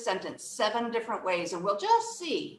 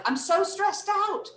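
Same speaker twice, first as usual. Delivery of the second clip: higher-pitched pretend overwhelm; mock stressed out; 9 semitones higher than usual. Teacher joking about the wrong kind of stress, mock frazzled. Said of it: "I don't mean stress like".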